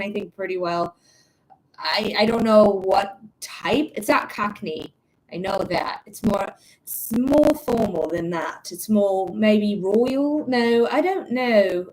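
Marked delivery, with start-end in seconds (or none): 1.8-11.9 put-on formal British accent; unhurried, would-be royal British accent. Shown as a demonstration. Host showing viewers the accent, formal British. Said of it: "I can do a British accent".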